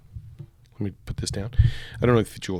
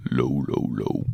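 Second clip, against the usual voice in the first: deep low demo; 11 semitones lower, slower than usual. Shown as a demonstration. Demoing lows.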